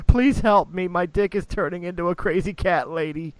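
hammed-up high plea; mock high plea. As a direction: beg like a shrieking cat lady.

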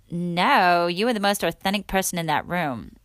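imitated American accent; quoting someone in an attempted American accent. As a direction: put on an amateur American accent. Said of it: "discrediting her American accent".

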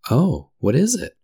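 high, startled question; alarmed cry. A narrator's reading. Dorothy hearing bad news, a high startled cry. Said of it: "cried Dorothy".

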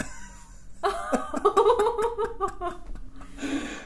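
long high breathy laugh; real laughter. Real, not acted. Friend cracking up after the voicemail story, high laugh. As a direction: lose it completely at the punchline. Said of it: "he goes".

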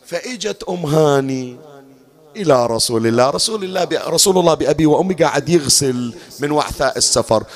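normal talking voice

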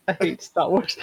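high-pitched, laughing imitation of a sulk; mocking mimicry. Friend teasing how it was said, mocking mimicry. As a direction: mimic a sulky kid through laughter. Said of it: "The way you said it, you're like".

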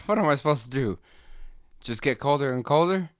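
high, loud whiny complaining voice; Jason Alexander coffee. Retold or imitated. The coffee cup complains to the worker in a high, loud whine. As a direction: whine and yell like a neglected cup. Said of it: "voiced by Jason Alexander".

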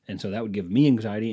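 ordinary talk, nothing marked

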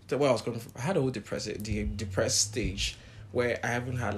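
ordinary delivery

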